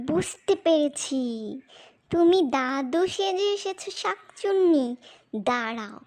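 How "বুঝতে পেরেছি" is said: normal talking voice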